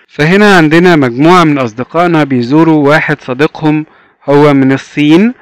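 ordinary talk, nothing marked

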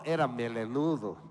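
normal talking voice

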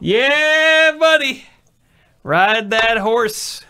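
loud, high, drawn-out holler; whooping cheer. Real, not acted. Friend egging on the drinker, loud whooping cheer. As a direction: holler them on, stretching the words.

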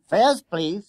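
high, loud conductor's call; bus conductor calling fares. A narrator's reading. Conductor demanding fares from two schoolboys, loud official call.

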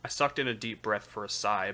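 usual speaking voice

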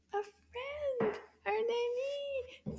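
high, fast chirpy puppet; playful high puppet chatter. Pretend. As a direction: play the little puppet eagerly. Puppet voice continues.

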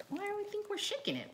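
plain reading voice

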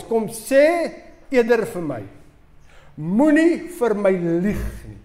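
slow, firm warning from a dad; stern father. Acted. Father to his sons about the window, slow stern warning. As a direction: lay down the law, slowly.

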